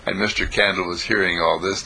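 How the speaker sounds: ordinary delivery, nothing marked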